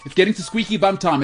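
usual speaking voice

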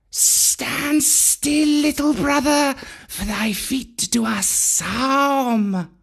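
high, slow serpent warning; cobra voice. Acted. Cobras telling Mowgli to keep still, slow serpent voice.

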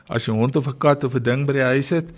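ordinary delivery

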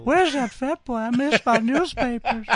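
put-on high, drawn-out old man voice; creepy old fellow calling out in a high voice; old man impression. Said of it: "that old guy who sort of kinda talks like this".

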